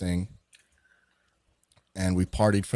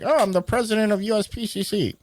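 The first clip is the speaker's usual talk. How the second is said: high, put-on introduction voice; imitated caller; 12 semitones higher than usual. Unknown caller names his title to the storyteller, high imitation. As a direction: imitate him introducing himself, pitched way up. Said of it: "And he was like".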